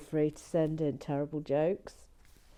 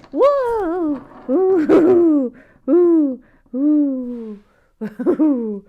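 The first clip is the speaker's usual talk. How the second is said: loud high startled ooh; real startled yelps breaking into a laugh; 9 semitones higher, 17 dB louder, much slower than usual. Real, not acted. Startled when the minifigure skits off. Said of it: "That's always a bit startling".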